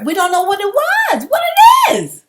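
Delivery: high, loud, fed-up burst; loud exasperated yell; exasperated outburst. Real, not acted. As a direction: throw it out in frustration. Ranting, voice jumps to shout.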